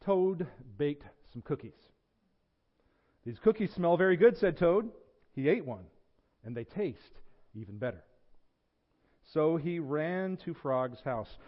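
animated read-aloud with lifted character lines; story reading. A narrator's reading. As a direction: tell it like a children's picture book. Reads a children's story to congregation.

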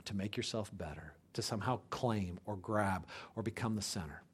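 low, soft pastoral appeal; hushed preaching. Real, not acted. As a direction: bring the sermon right down, quiet and earnest. Preacher drops to intimate, earnest appeal.

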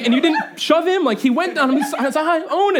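high, loud, stumbling disbelief; hammed-up amazement. Dad quizzing wife about baby rolling over, amazed. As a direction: stammer it out, blown away.

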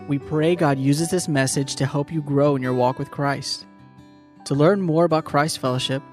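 usual speaking voice

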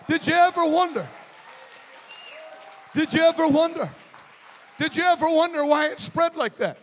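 loud, rising preacher's call; preaching at full force. Acted. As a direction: preach it out to the crowd.

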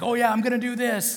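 high-pitched eager blurting, acted; mock brash outburst. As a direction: blurt it out like an overconfident disciple. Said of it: "he was a little bit brash".

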